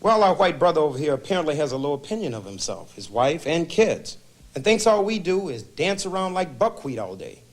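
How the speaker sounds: high-pitched, scornful verdict; angry. Acted. Mister Jackson answering the ranting husband in therapy, angry. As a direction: pitch it high and needle him. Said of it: "I'm sensing anger".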